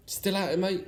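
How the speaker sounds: a chirpy passing milkman calling up. Acted. Milkman greeting Father Christmas at dawn, high and chirpy. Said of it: "asked the milkman".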